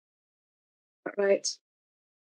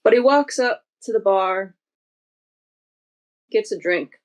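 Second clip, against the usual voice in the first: usual speaking voice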